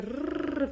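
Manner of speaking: long, strongly trilled "r"; rolled r. Shown as a demonstration. Teacher demonstrating the Russian letter sound to learners, rolled r. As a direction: roll the "r" out hard and long. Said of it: "we don't roll it that much like I do right now".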